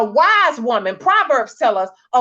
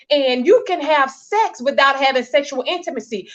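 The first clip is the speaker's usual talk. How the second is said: ordinary talk, nothing marked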